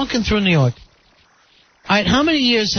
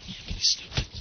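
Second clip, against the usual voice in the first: whispering; 11 dB quieter, faster than usual. Real, not acted. Whispered aside about Al.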